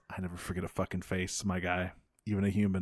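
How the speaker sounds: low, quiet, quick menacing threat. Retold or imitated. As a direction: lean in and threaten under your breath. Retold villain threat.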